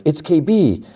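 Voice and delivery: high-pitched retold exclamation; excited realisation. KB's mom sees her daughter's signal, excited. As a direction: imitate a mother's sudden hopeful cry.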